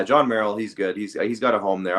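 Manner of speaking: usual speaking voice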